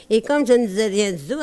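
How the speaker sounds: quick patter, tossed away; rattled off fast. Acted. Comic singer rattling off the refrain, fast patter. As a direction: rush through the refrain line.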